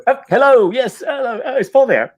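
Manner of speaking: high, chirpy mock telephone politeness; polite phone voice. Canadian caller asking for Paul, high and chirpy. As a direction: play it as an overly cheerful caller. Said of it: "he goes".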